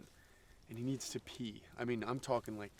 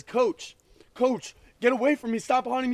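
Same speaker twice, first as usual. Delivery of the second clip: scared pleading; 13 semitones higher, 12 dB louder than usual. Acted. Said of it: "He says".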